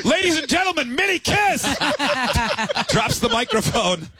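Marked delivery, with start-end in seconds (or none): 0.1-1.6 rising high into a big mock announcement; playful emcee calling out the band; announcer intro. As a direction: shrug it off then hype the band. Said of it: "Into the microphone while looking at us".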